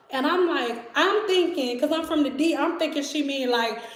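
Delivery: normal talking voice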